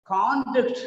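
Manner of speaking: "Conduct" as high, strong CON-duct; first-syllable stress. Shown as a demonstration. Teacher showing the noun, stress on CON in "Conduct". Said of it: "the first syllable is stressed".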